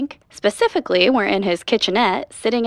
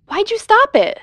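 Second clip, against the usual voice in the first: indignant; 13 semitones higher, 5 dB louder than usual. Acted. Upset the playback stopped.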